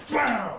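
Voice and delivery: high, sudden bam; sharp vocal impact; imitated strike. Shown as a demonstration. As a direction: smack it out as the hit lands. Imitates a second strike landing.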